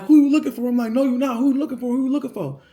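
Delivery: loud through the door. Retold or imitated. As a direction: shout the question through the door.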